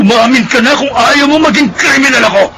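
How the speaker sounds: high, loud threatening yell; furious threat. Acted. Armed husband threatening his wife, furious. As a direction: bark the threat at her.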